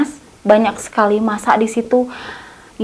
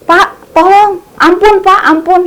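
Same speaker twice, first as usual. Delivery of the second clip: higher, louder desperate plea; begging for mercy; 8 semitones higher, 4 dB louder, slower than usual. Retold or imitated. Thief clings to the officer's legs, begging for mercy. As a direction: beg the officer to spare you. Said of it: "si tersangkanya bilang kayak gini".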